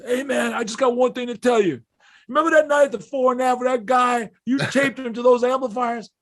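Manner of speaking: high, loud imitation of an excited guy; acted loud, high-voiced fan. Acts out excited man recognizing him.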